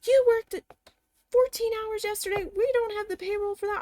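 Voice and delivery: imitating the manager's high-pitched scolding; angry yelling. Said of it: "she starts yelling at me".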